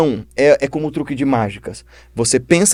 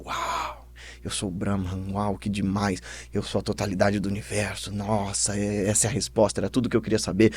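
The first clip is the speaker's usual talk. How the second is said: soft, low murmur of wonder; hushed awe; 4 semitones lower, 10 dB quieter than usual. Acted. Boy alone under a tree, realizing he is Brahman, hushed awe. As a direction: marvel quietly to yourself as a dazzled disciple.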